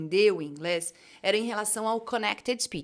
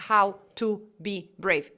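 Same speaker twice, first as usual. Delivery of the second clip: careful slow "how to be brave"; separated words; 2 semitones lower, much slower than usual. Shown as a demonstration. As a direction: pronounce each word on its own. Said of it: "Tá certinho a pronúncia".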